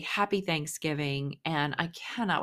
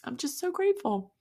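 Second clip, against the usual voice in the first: real tearful gratitude, higher and wavering; choked up; 10 semitones higher than usual. Real, not acted. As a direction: voice cracking high with tears held back. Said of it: "And I'm not gonna cry".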